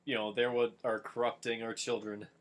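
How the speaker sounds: normal talking voice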